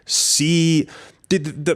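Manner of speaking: ordinary talk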